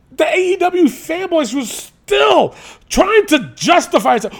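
a rising indignant shout; heated rant. Real, not acted. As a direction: rant at the fanboys, full force. Ranting about fanboys.

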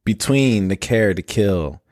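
ordinary talk, nothing marked

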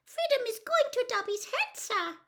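very high squeak with a touch of sadness; Winky, sad. A narrator's reading. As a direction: lament it as a tiny house elf. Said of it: "said Winky sadly".

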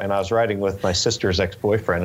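normal talking voice

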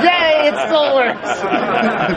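high, slow, laughing cheer; delighted cheer. Retold or imitated. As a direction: savor the win with a laugh in the voice. Designer delighted by boy's reaction.

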